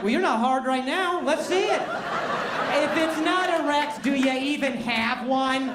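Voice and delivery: high, slow teasing jab. Retold or imitated. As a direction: needle him, drawn out.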